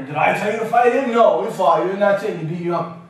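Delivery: a hint of a loud, scolding mother; scolding mom. Mother scolding her son for fighting, loud and scolding. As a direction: tell him off like an exasperated mom. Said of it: "I can just hear her".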